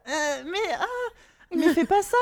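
high, loud protest breaking into laughter; hammed-up, laughing exasperation at the cartoon; mock dismay. Acts out exasperated reaction to accent. (protestation outrée, surjouée et rieuse)

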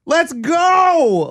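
high, loud rallying cry; excited shout. Shown as a demonstration. Fan showing the coach how to pump up, excited shout. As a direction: fire the team up. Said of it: "where is the excitement".

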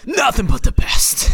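high, slow mock boss voice; boss impression. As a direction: drag out the boss's catchphrase up high. Mimicking boss's catchphrase.

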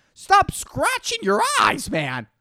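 high slow exasperated telling-off; exasperated scold. Retold or imitated. Calling out eye scratchers.

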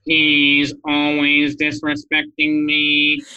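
slow, loud, level robot drone. Shown as a demonstration. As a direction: drag it out like a robot. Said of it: "say it with a robot voice".

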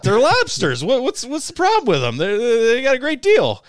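very high, loud, hammed-up innocent voice; hammed-up high buddy defending his cheap lobsters; squirrely buddy. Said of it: "And he goes".

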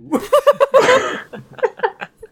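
high burst of laughter. Real, not acted. Laughing at own impression.

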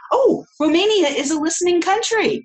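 light up at finding Romania on the list; excited. Real, not acted. Host thrilled to spot her guest's country, excited.

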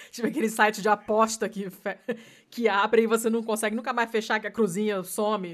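loud laughing speech. Real, not acted. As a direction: talk through laughter. Laughing while describing pop-up.

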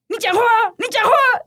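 high, loud scolding yell; scolding daughter. Retold or imitated. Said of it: "然后就骂他女儿". (妈妈冲着女儿吼：你讲话啊)

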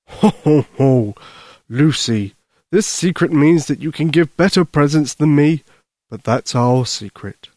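a "ho, ho, ho" Santa, then confiding; jolly Santa Claus. Acted. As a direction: chuckle as Santa, then share a secret.

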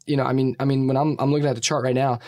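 normal talking voice

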